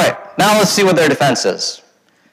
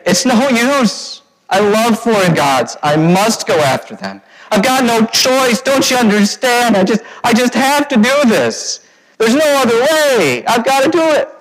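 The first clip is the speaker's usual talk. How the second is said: high, slow mock whining; hammed-up helpless addict voice; mock pleading; 6 semitones higher than usual. Preacher mimicking Israel's excuse to God, high mock whine.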